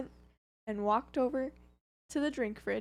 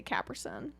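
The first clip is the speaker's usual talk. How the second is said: Capri Sun squashed into a lower put-on accent; put-on different accent; 7 semitones lower, 6 dB quieter than usual. Shown as a demonstration. Friend showing how the name gets said, put-on accent. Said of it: "go into a different accent".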